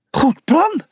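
high, perky agreement; suddenly cheerful. A narrator's reading. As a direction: pipe up happily. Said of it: "Hij was op slag weer vrolijk".

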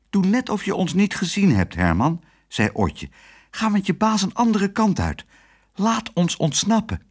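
put-on high, coaxing girl voice; sweet little girl. A narrator's reading. Otje coaxing Herman, high and sweet. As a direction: charm the dog gently.

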